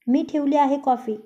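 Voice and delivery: plain reading voice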